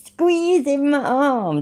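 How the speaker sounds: high, drawn-out mock whine. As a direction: mimic his complaint, stretched out and high. Mocking the man's earlier complaint.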